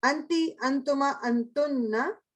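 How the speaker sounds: slow, drawn-out अंति, अंतुमा, अंतुन्ना with a rising end; drilled feminine pronouns. Shown as a demonstration. Teacher drilling feminine pronouns with the class, slow and stretched. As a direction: recite अंति, अंतुमा, अंतुन्ना slowly, stretching the last word. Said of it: "अब feminine".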